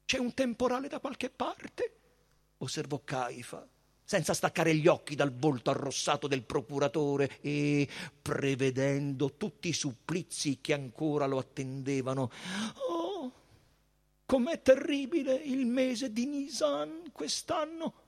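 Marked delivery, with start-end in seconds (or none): 0.1-1.9 high, measured character voice; high priest's voice. A narrator's reading. Caifa remarks to the procurator, in a high priestly voice. 12.9-17.9 high, measured character voice; high priest's voice. A narrator's reading. Caifa remarks to the procurator, in a high priestly voice.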